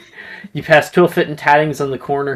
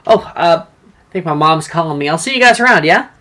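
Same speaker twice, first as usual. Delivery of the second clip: lightly voiced boy, a bit louder; Morgan making excuses; 4 semitones higher, 5 dB louder than usual. Morgan slipping away from the group, mildly raised boy's voice. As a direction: excuse himself in a breezy hurry. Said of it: "he says".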